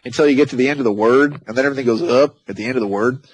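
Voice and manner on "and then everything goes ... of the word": usual speaking voice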